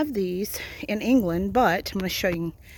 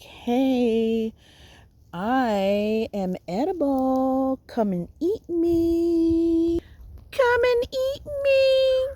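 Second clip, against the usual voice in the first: slow, drawn-out call climbing to very high; playful put-on voice, stretching every word higher; blossoms calling out; 10 semitones higher, much slower than usual. Edible blossoms beckoning from all over the place, calling out. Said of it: "They're like".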